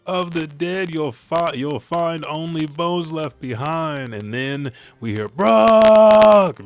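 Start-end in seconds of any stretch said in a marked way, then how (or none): 0.1-4.1 mild mock yell. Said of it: "Brooke yells out". 5.3-6.7 loud, high name called from afar; distant yell. Retold or imitated. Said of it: "being yelled".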